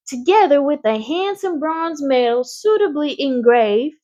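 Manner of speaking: high, formal announcer voice; fair announcer. A narrator's reading. Announcer awarding the prize at the fair, formal and high. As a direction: announce the prize grandly to the crowd.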